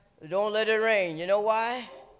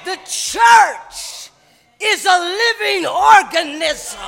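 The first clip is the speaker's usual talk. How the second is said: loud, high preacher's cadence; shouting sermon to the congregation; full-force preaching; 9 semitones higher, 15 dB louder than usual. Acted. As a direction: preach it hard, driving each phrase.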